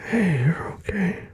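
slow, whispered reassurance; calm her down gently; soothing whisper. Acted. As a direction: boyfriend soothing his crying girlfriend, slow whisper. Soothing crying partner.

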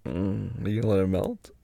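usual speaking voice

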